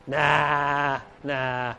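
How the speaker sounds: drawn-out sarcastic "nah"; mock denial. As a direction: playfully deny it, stretching the word. Joking denial that church people complain.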